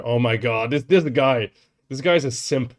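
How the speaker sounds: loud, teasing outburst; excited teasing. Real, not acted. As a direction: call him out with loud glee. Mocking the guy on screen.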